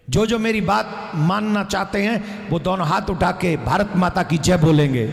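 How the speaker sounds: ordinary delivery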